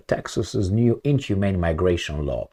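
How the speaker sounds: ordinary delivery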